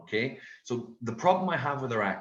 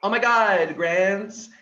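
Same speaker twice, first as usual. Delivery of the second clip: girlfriend impression; 5 semitones higher, 7 dB louder, much slower than usual. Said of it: "she'll say things like".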